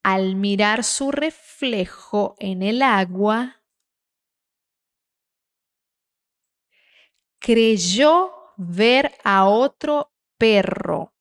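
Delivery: very slow, measured Spanish read-aloud; slow careful reading. Shown as a demonstration. Teacher reading a Spanish text for learners, very slow and careful. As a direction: read it unhurried, stretching each phrase with long pauses. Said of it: "Devagarzinho, hein? Sem pressa".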